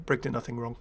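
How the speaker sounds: ordinary delivery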